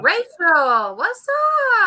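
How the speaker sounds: high, loud sing-out hello; excited greeting. Real, not acted. Host hails an arriving friend, excited greeting. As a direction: call it out high and drawn out. Greeting Rachel joining the live.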